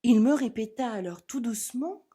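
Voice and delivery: ordinary delivery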